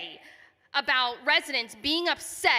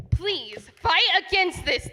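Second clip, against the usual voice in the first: normal talking voice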